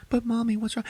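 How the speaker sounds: very high childish mock voice; a small child asking worriedly; lightly voiced little daughter; little girl.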